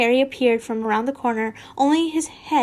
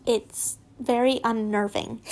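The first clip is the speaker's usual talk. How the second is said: ordinary delivery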